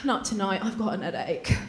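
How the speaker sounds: a hint of a tired British husband; understated mock headache excuse; husband declining. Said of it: "And he's like".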